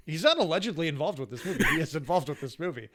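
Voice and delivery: amused protest. Real, not acted. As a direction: correct them loudly through a laugh. Amused correction of co-host's joke.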